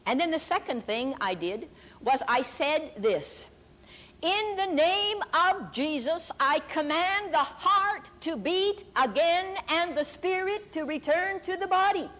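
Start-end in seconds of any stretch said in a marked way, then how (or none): 4.2-12.1 commanding prayer. Retold or imitated. Commanding the dead to revive.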